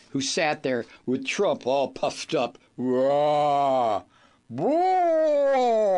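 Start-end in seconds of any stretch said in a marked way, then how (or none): 2.8-5.9 loud, rising blustering "Wah!"; mock bluster cry. Host mocking a puffed-up Trump, loud mock bellow. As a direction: ham up a puffed-up bully's roar.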